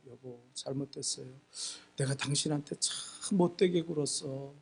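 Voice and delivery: very soft, low confession; lightly acted, hushed remorse; retold tearful apology. Wife apologizing to seated husband, soft retold remorse. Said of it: "울면서 미안하다 그랬더니요".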